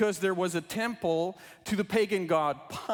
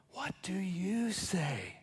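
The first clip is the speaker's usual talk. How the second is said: slow whispered question to the listeners; 7 dB quieter, slower than usual. Acted. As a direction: whisper it softly, drawing out each word. Whispered question closing children's book.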